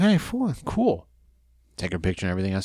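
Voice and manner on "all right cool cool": much higher, overeager yes; mock eager agreement. Fan agreeing to Finkel's price, mock eager and much higher. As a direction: play it up like a starstruck fan. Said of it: "I'm like".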